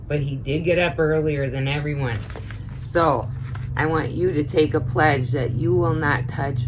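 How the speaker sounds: usual speaking voice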